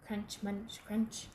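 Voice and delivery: ordinary delivery, nothing marked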